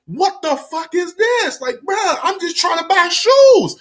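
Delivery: high, drawn-out acted protest; exasperated disbelief. Kid holding robbers' bag, exasperated disbelief.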